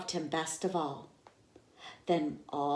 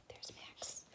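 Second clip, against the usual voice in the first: soft whispered aside; 21 dB quieter than usual. Acted. Teacher showing the picture to children, whispered. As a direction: point him out in a hush.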